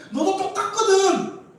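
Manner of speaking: 아주 높고 크게 길게 끌며 쏘아붙이기; 너도 다를 것 없다는 듯 높은 소리로 받아치기; 되받아치기. Acted. 상대를 되받아치는 말. (목청을 확 높여 얄밉게 되받기)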